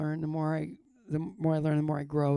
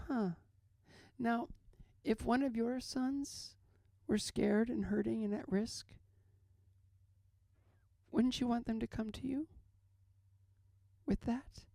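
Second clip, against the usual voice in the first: higher, unhurried imitation with long pauses; odd nurse; 5 semitones higher than usual. Nurse reasons with praying father, imitated higher with long pauses. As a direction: lightly play an odd nurse leading someone gently to the point. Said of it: "she had a weird energy to her".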